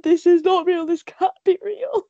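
high-pitched mock outrage; distraught disbelief, retold. Fan watching everybody dying, mock-distraught.

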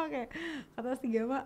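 ordinary talk

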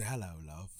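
put-on very low, soft British accent; smooth, quiet British purr; Klaus. Smirking British vampire greets someone, smooth low British accent. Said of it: "the accent, the, the British shit".

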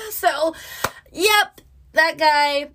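high laughing words, drawn out slow. Real, not acted. Still laughing about the Uber driver, giggling slowly.

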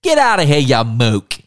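hammed-up fake New York accent, mook barked high and loud; mock a kid faking a New York tough-guy accent; bad New York accent. Podcaster mocking a British kid's fake New York accent. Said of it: "so he's terrible".

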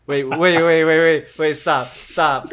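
flustered wait. Real, not acted. Man realizes he says words weird, flustered. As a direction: cut them off in laughing panic. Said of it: "panicked about saying word weird".

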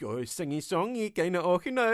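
exaggerated West Coast Glaswegian lilt, pitch swooping up; Glaswegian sing-song. Guest mimics people's default Scottish accent, sing-song Glaswegian. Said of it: "a kind of West Coast Glaswegian-esque".

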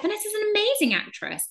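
a high, gushing mock agent praising her client. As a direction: lightly send up the agent's fake praise. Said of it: "She's like".

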